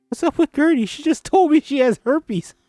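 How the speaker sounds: Ruby confiding, high and bright. Acted. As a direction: voice her as a young girl. Ruby voiced asking about Gertie.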